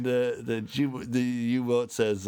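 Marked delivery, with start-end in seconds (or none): none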